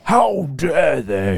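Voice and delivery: slow, drawn-out indignation; hammed-up affronted disciple; mock indignant. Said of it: "the other disciples were indignant".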